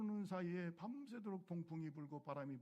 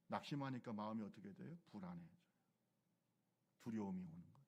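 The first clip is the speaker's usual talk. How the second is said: ordinary talk, nothing marked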